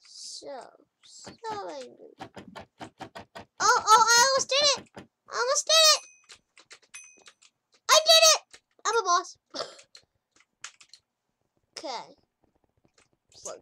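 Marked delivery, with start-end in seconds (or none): 3.6-9.4 high, loud, gleeful outburst; excited shouting. Real, not acted. Gamer pulling off a move, shouting excitedly. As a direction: shout your win out loud. Nearly, then succeeds in game.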